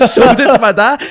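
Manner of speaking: loud, slow, laughed-out delivery; laughing punchline. Real, not acted. Storyteller delivering the punchline about his dad, laughing loudly.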